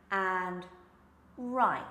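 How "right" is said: "right" with rounded lips and the tongue curled back; clear R. Shown as a demonstration. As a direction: push the lips forward for the R in "right". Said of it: "and that's the R".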